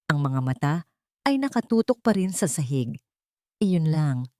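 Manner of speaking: ordinary delivery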